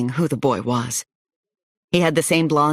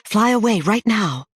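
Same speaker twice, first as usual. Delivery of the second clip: urgent yell; 6 semitones higher than usual. Acted. Narrator ordering the kids to fly away, urgent yell. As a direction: push them into the air with your voice. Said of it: "I yelled at the kids".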